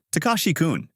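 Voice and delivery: calling out. A narrator's reading. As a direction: call after someone leaving. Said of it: "Sasaki calls out".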